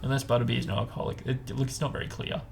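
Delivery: normal talking voice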